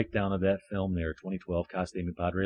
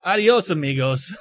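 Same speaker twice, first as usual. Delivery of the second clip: put-on Spanish accent; 6 semitones higher, 6 dB louder than usual. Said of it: "I should say something in, uh, Spanish".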